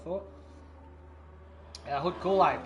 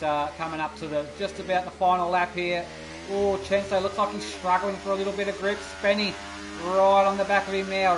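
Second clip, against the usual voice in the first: live race commentary; 4 semitones higher, 4 dB louder than usual. Acted.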